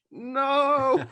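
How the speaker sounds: high, loud comic refusal; mock refusal. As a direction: cry out a dramatic no. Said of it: "you're like".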